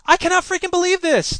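very high, loud exasperation; outraged disbelief. Acted. Dave learns they were all acting, outraged. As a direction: burst out, unable to believe it.

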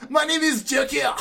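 high, theatrical Joker voice; hammed-up Joker announcing himself; mock villain Joker. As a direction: reassert the name in a high villain pitch. Joker reasserts name after French remark.

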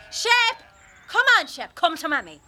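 high, loud call across a field; loud high call to the dog. Acted. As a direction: shout for the dog to come. Coaxing the dog loudly.

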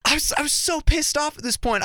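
ordinary delivery

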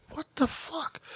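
very soft, high disbelief; stunned aside. Retold or imitated. Shopper in line overhearing a tirade, stunned aside. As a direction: say it under your breath, aghast.

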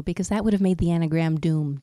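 usual speaking voice

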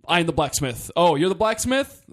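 mock dialogue. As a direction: act out a hammy reveal and reaction. Mocks the rushed plot as dialogue.

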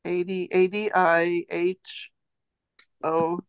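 ordinary talk

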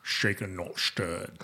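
low, quiet Sean Connery voice; Sean Connery impression. Quoting Sean Connery's Bond line.

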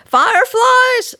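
very high, loud, drawn-out shout; piercing, stretched-out announcement; excited cry. A narrator's reading. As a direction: yell out the big surprise. Parents burst in with a scare.

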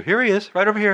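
high-pitched put-on voice; high, hammed-up accuser; mock informer. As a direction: point someone out in a high mock voice. Acting someone pointing him out.

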